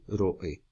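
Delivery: héroe as HÉÉ... ro-e; stressed first syllable, split. Shown as a demonstration. Teacher showing an esdrújula, héroe slowed with stress on hé. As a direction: lean slowly on the hé of héroe. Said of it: "son tres sílabas".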